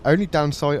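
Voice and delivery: ordinary delivery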